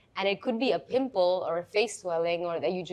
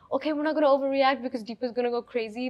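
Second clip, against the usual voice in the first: mock restrained panic; 5 semitones higher, 3 dB louder, faster than usual. Team hiding alarm.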